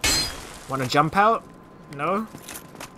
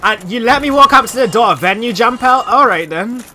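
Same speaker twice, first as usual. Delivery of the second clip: indignant; 8 semitones higher, 9 dB louder than usual. Real, not acted. Complains after being ambushed.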